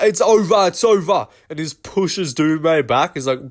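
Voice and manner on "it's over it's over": higher mock ref call, fading down; hammed-up referee waving the fight off; Mark Goddard stopping it. Imitating the ref calling off the fight, higher. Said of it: "And he's like".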